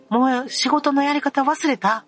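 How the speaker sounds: high, mocking imitation of a snide question; condescending coworker. Coworker belittling the speaker over the software, mock snide. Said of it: "みたいな言われて".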